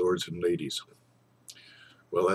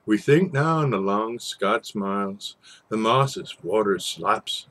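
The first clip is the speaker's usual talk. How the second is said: lilting Scots verse reading, rising and falling; poem recital; 4 semitones higher, slower than usual. A narrator's reading. Storyteller reciting a Burns poem by night. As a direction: recite the old ballad with relish. Reads Tam O'Shanter poem aloud.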